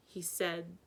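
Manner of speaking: ordinary delivery, nothing marked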